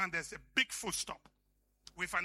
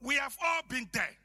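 loud, high preacher's cry; preaching at full force; 11 semitones higher, 5 dB louder than usual. Acted. Preacher pleading with the church in a loud cry. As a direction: build the sermon to a shout.

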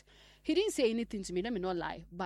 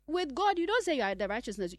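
higher, louder punch; preaching emphasis; 5 semitones higher, 7 dB louder than usual. Acted. Preacher stressing honesty before God. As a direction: hit it hard to make the point.

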